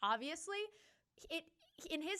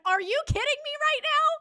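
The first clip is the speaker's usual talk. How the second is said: higher, much louder outraged yell; retold outraged shout; 12 dB louder than usual. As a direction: scream it at him in disbelief. Retold fight with boyfriend.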